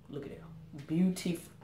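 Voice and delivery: breathless whisper of wonder; awed whisper. Real, not acted. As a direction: whisper it in awe. Whispered awe over gift.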